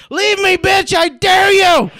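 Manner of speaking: high, loud shrieking wife; retold scream. Wife screaming in his face at night, loud shrill shout. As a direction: yell it like she is waking him up to fight. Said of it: "with her screaming in my face".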